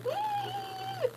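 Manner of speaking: very high, soft squeak; a tiny overwhelmed squeak; starstruck squeak. Retold or imitated. Shy squeak after birthday wish.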